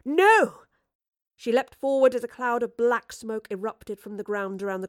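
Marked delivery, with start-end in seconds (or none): 0.1-0.6 high, loud cry of protest; desperate shout. Acted. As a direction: shout it desperately at the fleeing creature. Professor cries out as it escapes.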